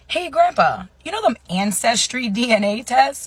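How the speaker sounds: high, loud approach to grandpa; casual-sounding opener. Retold or imitated. Acted careful opener to grandfather.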